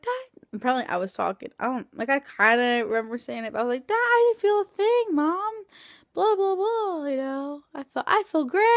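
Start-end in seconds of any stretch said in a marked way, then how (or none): 0.0-0.4 high drawn-out call; mock groggy call to dad. Groggy after anesthesia. 3.9-8.6 high giddy rambling; imitating her loopy post-op self; drugged babbling. Babbling after anesthesia.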